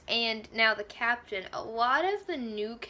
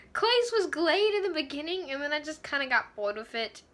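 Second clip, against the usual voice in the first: usual speaking voice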